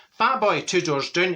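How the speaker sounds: normal talking voice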